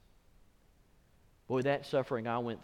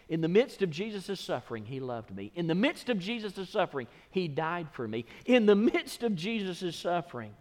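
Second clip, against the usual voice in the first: each repeat of the refrain rising higher and louder; building refrain; 5 semitones higher, 4 dB louder than usual. Real, not acted. Preacher driving his point home, refrain climbing each time.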